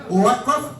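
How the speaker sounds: mock the boyfriend's cocky hello; a lad saying "All right, bruv" with a rising end; laddish bruv voice. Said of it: "He went".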